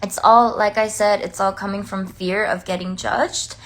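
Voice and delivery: usual speaking voice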